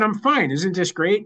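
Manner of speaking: high, falsely upbeat reassurance; deflecting. Retold or imitated. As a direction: dodge the question with put-on cheer. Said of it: "I tried to deflect".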